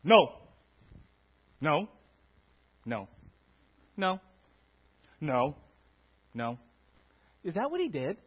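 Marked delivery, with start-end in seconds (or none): 0.0-6.6 loud flat refusal; stern no. Pretend. Preacher mimics a refusing teacher, loud stern no. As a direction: bark the no. Mock Jesus answering no.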